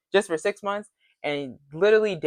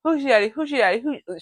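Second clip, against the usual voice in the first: higher-pitched, rattled-off repeated catchphrase; insistent repetition; 6 semitones higher than usual. Retold or imitated. Castmate who won't stop saying it, insistent mimicking. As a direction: imitate her repeating it over and over. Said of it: "she said it, like, twenty times".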